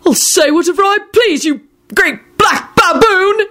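high defiant cry, slowing word by word; triumphant shout. A narrator's reading. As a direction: hurl the insult in triumph. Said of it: "he shouted triumphantly".

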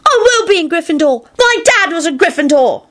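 put-on high, protesting child voice; indignant boy. A narrator's reading. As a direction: insist hotly like an offended child. Child indignantly contradicts Snape.

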